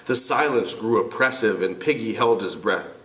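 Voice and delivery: ordinary talk, nothing marked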